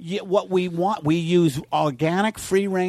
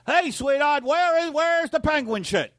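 high, slow hammy sitcom line; sitcom actor voice; 10 semitones higher than usual. Retold or imitated. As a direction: play it like a cheesy sitcom actor. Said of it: "To do a sitcom, all you gotta do is like".

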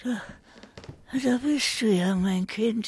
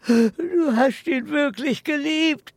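higher, louder anguished cry; distraught outburst; 8 semitones higher, 6 dB louder than usual. Acted. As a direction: cry it out in disbelief. Old man's distraught outburst.

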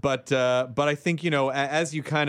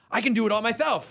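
higher-pitched acted boast; mock self-reliance; 6 semitones higher than usual. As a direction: lightly mimic a do-it-all attitude. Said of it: "digital culture mentality is".

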